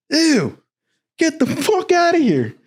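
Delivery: very high, grossed-out exclamation; mock revolted officer; disgusted dismissal. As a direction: recoil in disgust with the voice shot up.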